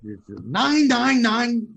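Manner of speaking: a hint of a barked German count; lightly imitating a Nazi villain; German villain accent. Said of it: "Mittenhand talks in a very Gestapo Nazi kind of".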